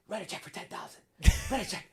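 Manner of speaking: whispered quick instruction; hushed order. Retold or imitated. Imitating David whispering to Natalie, hushed. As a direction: murmur the order aside. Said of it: "He'd turn to Natalie and be like".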